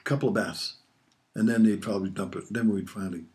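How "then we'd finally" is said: ordinary delivery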